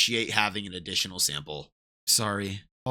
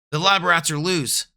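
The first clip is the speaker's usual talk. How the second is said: shouting guard; 7 semitones higher, 6 dB louder than usual. Acted. Guards raise alarm.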